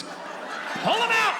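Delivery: very high, loud, stretched-out shout; hammed-up yell. Comic ends alphabet bit, high drawn-out mock yell. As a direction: yell it like a frantic command.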